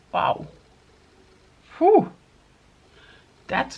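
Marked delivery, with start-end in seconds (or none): 1.8-2.1 excited whew. Real, not acted. Reacting to a beaming highlighter.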